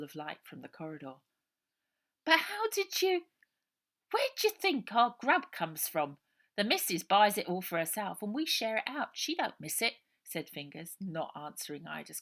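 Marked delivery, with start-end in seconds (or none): 2.2-9.9 high, loud urchin, brash and matter-of-fact; a scrappy kid bragging about stolen grub; cheeky street kid. A narrator's reading. Fingers' voice.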